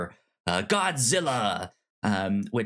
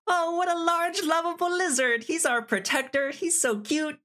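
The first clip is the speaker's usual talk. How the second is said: high, sugary gushing over a cuddly lizard; mocking the cute Godzilla fans, high and sweet; mock cutesy fan voice. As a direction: coo it like an adoring fan, much higher. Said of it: "ponderings to this, like".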